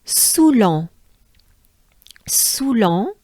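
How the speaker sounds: "Soulan" with a round ou and nasal "-lan"; "Soulan" soo-lan(n) through the nose at the end; French Soulan. Shown as a demonstration. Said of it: "ou sound".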